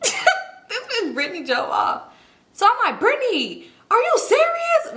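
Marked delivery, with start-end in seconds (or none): 0.0-2.0 high, drawn-out laugh-talk; incredulous, laughing as she tells it; laughing disbelief. Real, not acted. Laughing at being left behind. 3.0-5.0 high-pitched yell of disbelief; shrill, drawn-out re-enacted scream; retold scream. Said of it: "We scream".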